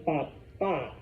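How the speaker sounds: slow "papa" as pa-PA; stress on final A. Shown as a demonstration. Teacher sums up word for dad, "papa" stressed pa-PA. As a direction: hold the last syllable of "papa". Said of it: "extra stress on the A".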